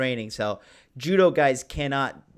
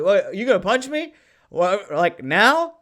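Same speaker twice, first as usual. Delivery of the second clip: eager, raised voice itching for a fight; louder, higher, mock-eager dare; acted thrilled challenge; 6 semitones higher, 7 dB louder than usual. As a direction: play delighted at being threatened. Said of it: "I'm, like, thrilled".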